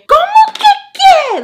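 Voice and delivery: very high, loud "Como que que?"; mocking a furious Spanish retort; mock outraged shriek. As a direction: shriek it back in sassy disbelief. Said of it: "she was so mad".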